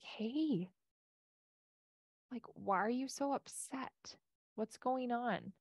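soft, slow and caring; gentle concern. Pretend. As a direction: ask softly, as if calming him down. Gentle question to an upset husband.

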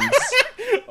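high loud burst of laughter; hysterical laugh. Real, not acted. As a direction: explode laughing. Laughing at friend's claim.

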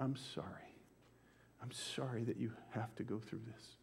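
soft, hushed, sorrowful comfort; a gentle, nearly silent apology to a hurting woman; tender apology. Retold or imitated. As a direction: barely voice it, full of sympathy. Tearful apology to the victim.

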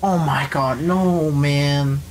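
ordinary delivery

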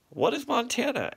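asked. A narrator's reading. Cygnets asking their father, high and puzzled. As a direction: ask it in a high little voice. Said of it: "asked all the cygnets".

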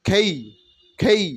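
"খেই" with an aspirated kh and an ay glide; breathy kh in "খেই"; khey sound. Shown as a demonstration. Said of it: "উচ্চারণ হবে খেই". ("কে" নয়, "খেই")

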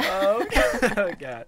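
a loud laughing oh God; loud laughing groan. Acted. Classmate cracks up loudly at the chicken joke. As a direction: crack up hard, loud and high.

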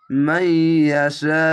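মাই ইয়াশা slow with nasal hold; slow recited মাই ইয়াশা; nasal ghunna recitation. Shown as a demonstration. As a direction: hum the গুন্না in মাই ইয়াশা. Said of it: "ঈদগামে বা গুন্না".

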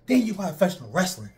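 ordinary delivery

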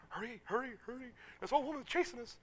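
lightly hammed-up alarm; mild mock panic. Preacher urging the bus on as a woman chases it, mock panicky.

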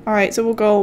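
ordinary delivery

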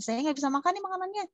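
a hint of a picky patient; complaining patient. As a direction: play the fussy patient refusing the food. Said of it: "Kata Hen".

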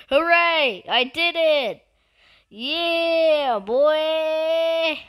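high, drawn-out celebration; triumphant cheer. Real, not acted. Player celebrating ending an opponent, triumphant cheer. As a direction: cheer the win, stretching the last words.

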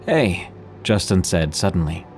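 usual speaking voice

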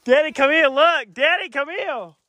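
loud, high call for Daddy. Real, not acted. Boy calling his dad over after his brother falls, loud urgent call. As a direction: shout for Daddy to come see.

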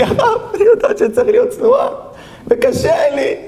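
very high squeaky tearful imitation; mock weeping girl. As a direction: imitate a crying girl in exaggerated falsetto. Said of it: "עמדה מולי מישהי בעכו בוכה". (חיקוי נערה בוכייה בקול דק)

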